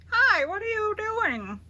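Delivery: high, loud greeting of a story figure; high character voice. Acted. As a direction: chirp it out as the newcomer. Character voice in tree.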